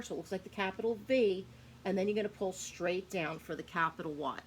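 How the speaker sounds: ordinary delivery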